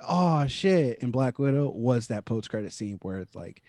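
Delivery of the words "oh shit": mock-stunned "oh shit", higher and louder; wowed reaction. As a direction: re-enact being caught off guard by a twist. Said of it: "really got me like".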